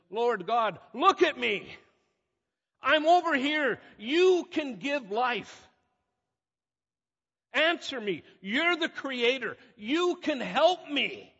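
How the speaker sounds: high, loud desperate plea to God; pleading cry. Retold or imitated. Preacher voicing David begging God, loud desperate plea. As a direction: call up to heaven, begging to be seen.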